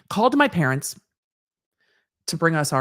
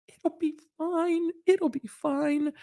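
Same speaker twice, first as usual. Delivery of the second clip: reassuring; 11 semitones higher, 5 dB quieter, slower than usual. Retold or imitated. Reassuring anxious husband.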